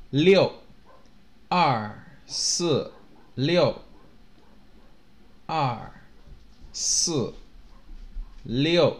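short, sharp drop on each of "二、四、六"; falling fourth tone. Shown as a demonstration. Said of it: "have the fourth tone".

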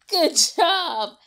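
ordinary delivery, nothing marked

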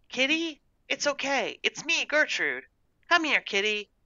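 put-on high little-girl coaxing voice; gentle coaxing. A narrator's reading. Girl in the shed to a hiding cat, coaxing gently.

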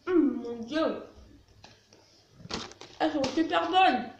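talking with food in mouth; savoring snack between words; chewing while talking. Real, not acted. Seen 0: mouth works as if chewing; he picks up chip can at 2.7.

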